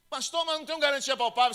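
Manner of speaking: high, loud complaining believer; doubting believer. Retold or imitated. Believer complaining to the pastor, high and whiny. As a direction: whine the objection to the pastor.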